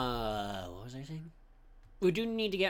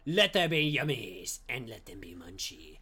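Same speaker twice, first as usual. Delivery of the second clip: mock scripture narration; 6 semitones lower than usual.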